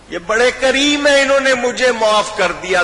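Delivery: high, loud pleading call to the army; پرجوش التجا; fervent appeal. Acted. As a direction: urge them with passion. Hurr urging the army.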